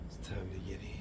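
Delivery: soft whispered aside; whispering. Real, not acted. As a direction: whisper it to yourself. Whispered aside before bath.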